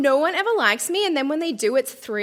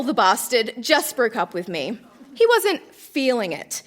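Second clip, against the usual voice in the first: ordinary talk, nothing marked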